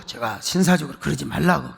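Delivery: usual speaking voice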